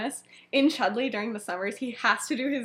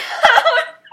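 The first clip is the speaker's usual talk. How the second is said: whispered laugh; 10 dB louder than usual. Real, not acted. Laughing mid-quote.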